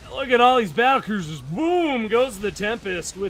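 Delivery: loud, high excited yell; excited shout. Real, not acted. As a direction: yell it out, thrilled by the explosion. Caster hyping huge battlecruiser fight.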